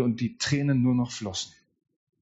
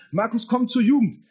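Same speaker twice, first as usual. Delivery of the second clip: higher, inviting call to youth group; friend inviting; 7 semitones higher than usual. Retold or imitated. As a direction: call him over with a lifted voice. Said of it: "Matthias Müller ruft mich an". (Matthias ruft einladend und höher)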